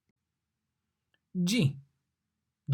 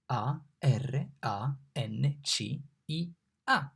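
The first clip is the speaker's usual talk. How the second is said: letters spelled out slowly; teacher spelling for the class; slower than usual. Shown as a demonstration. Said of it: "Come si scrive arancia?".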